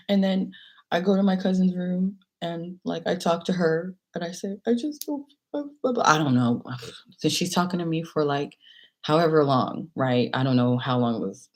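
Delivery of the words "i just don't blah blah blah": high whimpering retelling; tearful complaint. Retold or imitated. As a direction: mimic your upset self, trailing into babble. Retelling tearful talk with cousin.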